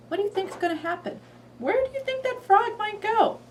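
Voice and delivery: high, bouncy teasing question; playful question to a child. Shown as a demonstration. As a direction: ask it like a curious kid.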